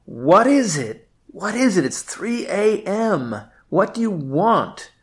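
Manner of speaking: a sleepy man yelling back from the window; groggy yell. Acted. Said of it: "yells back".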